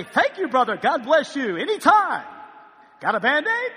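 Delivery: fake-gracious after taking a hit; mock politeness. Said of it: "you don't just come out of that and say".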